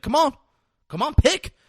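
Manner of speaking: high, loud urging; imitating an impatient demand; acted goading. Retold cop pressing suspect to choose.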